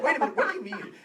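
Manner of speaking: high, loud mock indignation; retold angry disbelief, high and loud; imitating pissed-off filmmaker. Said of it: "she was so pissed".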